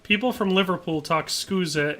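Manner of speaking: normal talking voice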